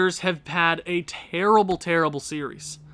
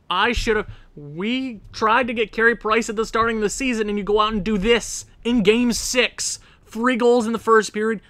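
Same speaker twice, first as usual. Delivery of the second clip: raised voice, indignant blame; exasperated rant; 4 semitones higher, 4 dB louder than usual. Real, not acted. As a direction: lay into the goalie who let you down. Berating his goalie.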